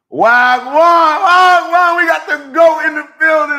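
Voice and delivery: very high, loud whooping holler; hollered hype greeting. Real, not acted. Host greets the GOAT arriving in chat, hollering excitedly. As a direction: whoop it out at full volume.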